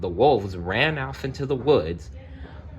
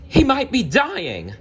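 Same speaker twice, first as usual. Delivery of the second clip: high, louder anguished cry; cried out; 4 dB louder than usual. Acted. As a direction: plead in alarm for a dying father. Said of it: "Belle cried".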